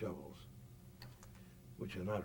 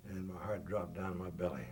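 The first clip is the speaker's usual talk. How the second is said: ordinary talk, nothing marked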